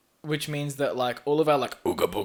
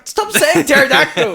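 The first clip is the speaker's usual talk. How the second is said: loud laughing protest; laughing shout; 13 semitones higher, 12 dB louder than usual. Real, not acted.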